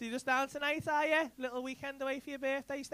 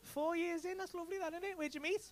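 ordinary talk

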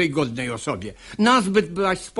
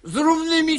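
angry command; 10 semitones higher than usual. Acted. Father silencing his daughter, angry. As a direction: cut her off harshly.

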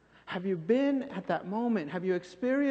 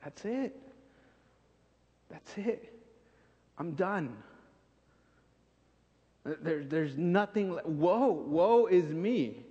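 Isaiah giving up, lightly acted; despairing cry; slower than usual. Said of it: "he cries out and he's like".

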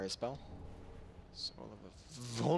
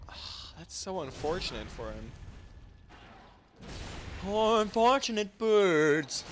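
mock pity; 8 semitones higher, 3 dB louder, slower than usual.

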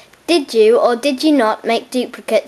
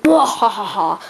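evil villain cackle; 3 semitones lower, slower than usual. Acted. Villain gloating over escape.

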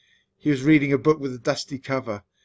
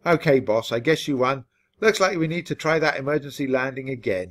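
plain reading voice

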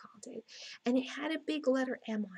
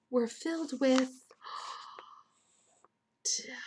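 awestruck gasp; 2 semitones higher than usual. A narrator's reading. Narrator revealing what fills the nests, awestruck whisper. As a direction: gasp and breathe the last word out in wonder.

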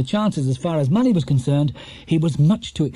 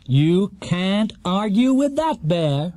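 slow, higher, smug exclamation; self-satisfied host; 6 semitones higher, much slower than usual. A narrator's reading. Quizmaster gloating at the bear, smug and slow. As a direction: beam it at the audience. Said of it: "he exclaimed".

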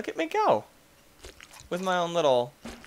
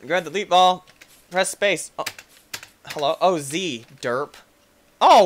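normal talking voice

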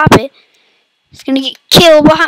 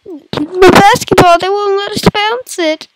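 fake crying; 7 semitones higher than usual. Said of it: "I'm fake crying".

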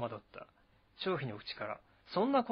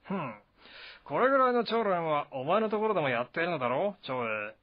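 higher, louder, swaggering general; Zhang Fei, taunting; 3 semitones higher, 5 dB louder than usual. Acted. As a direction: throw the challenge at him with a sneer.

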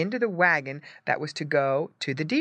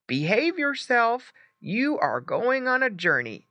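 higher, firmly voiced scolding parent; ordering pig papa; 7 semitones higher than usual. A narrator's reading. Papa pig to Squinty before his journey, ordering. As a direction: order the youngster to behave. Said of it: "ordered his papa in the pig language".

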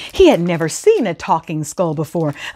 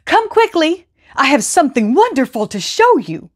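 higher, eager call to the neighbors; calling out excitedly; 8 semitones higher than usual. A narrator's reading. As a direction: wave everyone over, thrilled. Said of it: "he called out to his neighbors".

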